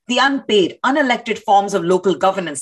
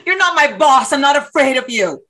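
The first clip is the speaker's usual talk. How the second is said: raised, high-pitched challenge; defiant anger; 3 semitones higher than usual. Acted. Protester squares up to cop.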